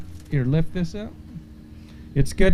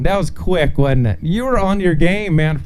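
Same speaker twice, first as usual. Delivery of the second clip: usual speaking voice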